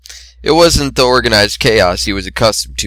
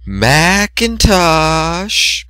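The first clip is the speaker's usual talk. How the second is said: very slow rising lovesick call; a hint of a sultry mare; sultry drawn-out call; 7 semitones higher, much slower than usual. A narrator's reading. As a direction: stretch his name out seductively.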